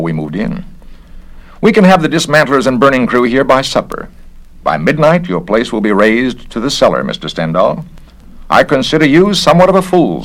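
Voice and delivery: the busybody official sizing him up; officious investigator. Acted. Garrett again.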